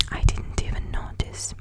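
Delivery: normal talking voice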